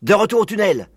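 loud, high order; commanding. A narrator's reading. Father fox sends cubs back underground, commanding. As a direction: order them back firmly. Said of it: "commanda-t-il".

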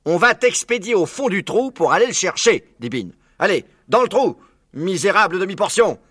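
loud, high scornful command; bullying order. Acted. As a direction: shove him with your voice. Bean bullying Buns.

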